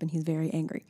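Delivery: usual speaking voice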